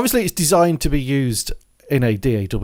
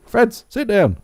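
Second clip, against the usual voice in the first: calling the dog; 12 semitones higher, 4 dB louder than usual. Real, not acted.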